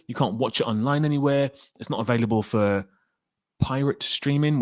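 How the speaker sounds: ordinary delivery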